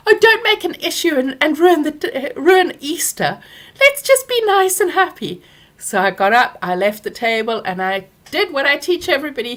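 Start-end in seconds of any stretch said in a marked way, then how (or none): 0.1-5.4 higher and louder mimic of mum smoothing it over; mock dismissive mum. Daughter mimicking mother shushing her at Easter, high and loud. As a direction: wheedle her to keep the peace.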